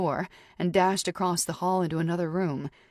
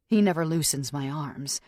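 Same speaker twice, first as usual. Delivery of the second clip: ordinary delivery, nothing marked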